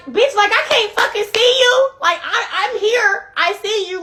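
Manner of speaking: high, loud, incredulous calling out across the bar; exasperated call-out. Retold or imitated. Woman calling out to the man sneaking from the bar, high and loud. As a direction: call out sharply to him as he sneaks off.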